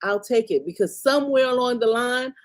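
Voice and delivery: ordinary delivery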